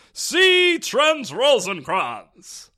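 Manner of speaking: high, drawn-out pompous name announcement; loud, lofty mockery, stretching every syllable; mock grandiose. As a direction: proclaim the name slowly with exaggerated grandeur. Mocking the reporter's one-letter first name.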